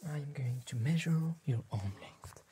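ordinary talk, nothing marked

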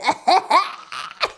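short real laugh. Real, not acted. Laughing at her own joke about the boss.

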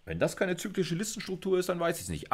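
high, amused ironic aside to the class; mock-incredulous quip. Real, not acted. Lecturer joking to students about an obvious cyclic list, high and amused.

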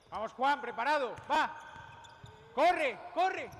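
loud calls across the court; barking gym coach. Real, not acted. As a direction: bark the drill calls. Coach calling players in drill.

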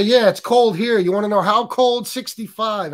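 mock-boastful friend rubbing it in. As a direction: taunt him like gloating friends. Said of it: "Your friends mock you and laugh at you".

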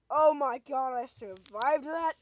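loud relieved disbelief; relieved outburst. Real, not acted. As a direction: exhale the words, amazed to be alive. Relief after close call.

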